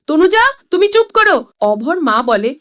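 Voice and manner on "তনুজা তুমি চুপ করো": loud, high furious shout; angry shout. A narrator's reading. Father shouting at his wife to shut up, furious. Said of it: "রেগে চিৎকার করে বলে".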